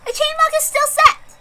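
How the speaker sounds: high, lilting proclamation of the witch; proclaiming witch. Acted. Witch overseer proclaims to the crowd, high and playful. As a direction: announce the verdict with a playful high voice.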